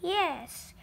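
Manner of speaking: ordinary delivery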